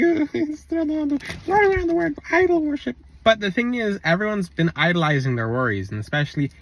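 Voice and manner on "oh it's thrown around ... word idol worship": high mocking whiny voice; mocking skeptic. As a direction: mimic the eye-rolling doubter, pitched way up. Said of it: "People are like".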